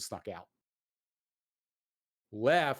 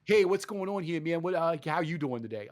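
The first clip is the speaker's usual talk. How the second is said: higher-pitched Brooklyn guy greeting; Brooklyn accent; 5 semitones higher than usual. Acted. Gamer mimicking an alien who greets the player, Brooklyn accent. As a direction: hail someone like a chatty Brooklyn local. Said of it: "sounds like a guy from fucking Brooklyn".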